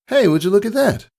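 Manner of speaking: high, bright-eyed filly voice; Pyra's voice. Acted. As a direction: pitch way up to play the cheeky pony. Pyra voiced in read story.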